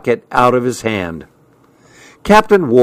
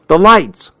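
high, loud call over the band; yelled over music; 7 semitones higher, 4 dB louder than usual. A narrator's reading. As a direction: shout it to Pete across the music. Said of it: "I yelled to Pete over the music".

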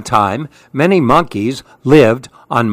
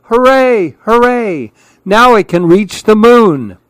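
high, loud cheer; little monkey calling up happily; joyful call; 8 semitones higher, 4 dB louder than usual. Acted. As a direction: cheer up the well in delight. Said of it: "called to the others".

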